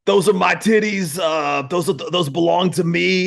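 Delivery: high, loud blustering politician; cartoonish mayor impression; Rudy Giuliani. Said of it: "can you do Rudy Giuliani".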